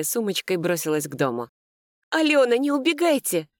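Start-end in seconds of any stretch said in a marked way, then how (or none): 2.1-3.5 high, urgent warning call; urgent shout. A narrator's reading. Narrator warns fleeing sister about the dog, urgent shout. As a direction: call after someone to stop them. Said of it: "крикнула я".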